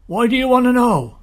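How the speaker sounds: loud, high shouted question; guard hollering back at an unknown rider; suspicious challenge. Acted.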